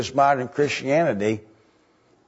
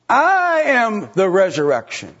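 preached proclamation; 7 semitones higher, 4 dB louder than usual. Acted. Preacher repeating Jesus' words.

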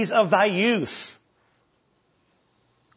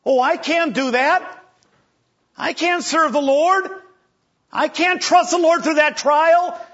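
mock whining; 5 semitones higher than usual. Preacher mimicking a fearful believer's excuses. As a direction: play the scared believer begging off.

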